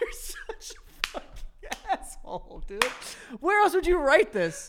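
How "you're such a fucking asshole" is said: laughing breathlessly. Real, not acted. Cracking up at friend's story.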